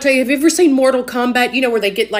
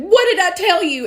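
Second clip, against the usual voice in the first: much higher, excited "What did I tell you?"; vindicated mom; 9 semitones higher than usual. Retold or imitated. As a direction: blurt it out like a mom who was proven right. Mom slaps table, vindicated.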